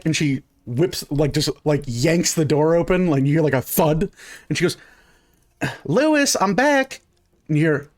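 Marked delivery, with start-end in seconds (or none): 5.8-7.0 put-on high, sing-out child's hello; little girl calling out. Little girl announcing herself to the shopkeeper, high call. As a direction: call in brightly at a much higher pitch.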